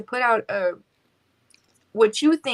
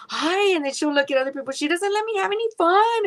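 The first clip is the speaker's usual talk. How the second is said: imitating mom; 9 semitones higher, faster than usual. Daughter mimicking her mom complaining to other people, playful high imitation. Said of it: "And she's like".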